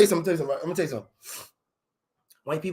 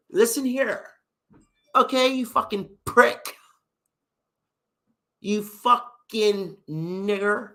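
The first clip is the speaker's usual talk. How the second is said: stretched words; 3 semitones higher, slower than usual. Retold or imitated. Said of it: "Always stretch your words".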